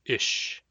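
"ish" alone, with a short vowel; shortened form. Shown as a demonstration. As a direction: say just a brief "ish", no "oh". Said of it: "can also be shortened to just".

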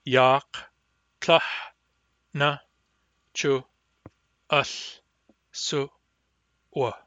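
each syllable said apart with pauses; syllable-by-syllable drill. Shown as a demonstration. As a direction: split it into separate syllables. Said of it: "By syllables".

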